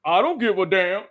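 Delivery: indifferent fan voice, rising to a loud last line; mock a careless fan who doesn't care. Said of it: "Some of y'all fans will be like".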